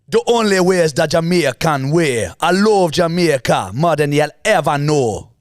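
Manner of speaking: put-on Jamaican accent, lilting. Shown as a demonstration. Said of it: "if I pull out a Jamaican".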